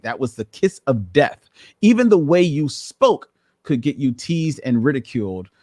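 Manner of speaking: normal talking voice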